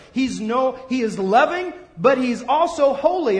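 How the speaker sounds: ordinary talk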